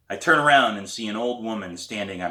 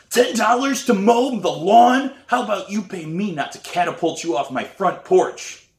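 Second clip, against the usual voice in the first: scornful neighbour; 11 semitones higher, 7 dB louder than usual. A narrator's reading. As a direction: sneer at the kid's offer. Grumpy neighbour mocks the offer.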